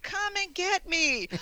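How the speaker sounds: high, slow teasing dare to the spirits; hammed-up come-and-get-me; mock taunt. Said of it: "He jumps on the bed and he's like".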